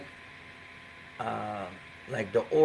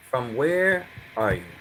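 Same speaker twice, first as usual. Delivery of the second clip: literal; slower than usual. Shown as a demonstration. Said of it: "Meaning, where are you from?".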